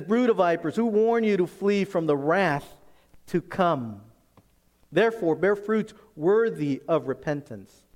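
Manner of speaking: loud, high fiery rebuke; preaching at full force. Acted. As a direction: thunder it like a prophet in the desert. Preaching John's words at full force.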